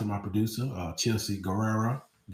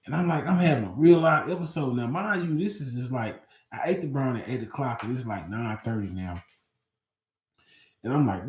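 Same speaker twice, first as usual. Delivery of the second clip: ordinary delivery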